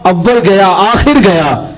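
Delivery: slow, lifted recital of a naat couplet; slow verse recital. Retold or imitated. Preacher calling listeners in with a verse, slow recital. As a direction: stretch the couplet out as a chanted line. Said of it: "ہم تو کہتے ہیں".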